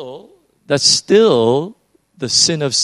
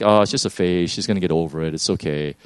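lower, softer calm reassurance; reassuring; 7 semitones lower, 4 dB quieter, faster than usual. Retold or imitated. Dad reassuring his wife about their daughter, softly calm. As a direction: brush off the worry gently. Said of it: "You know, I'm like".

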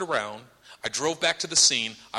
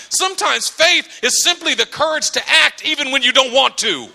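louder, higher emphatic sermon delivery; forceful preaching; 10 semitones higher, 9 dB louder than usual. Real, not acted. Preacher teaching congregation what faith is, forceful. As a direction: drive each point home to the congregation.

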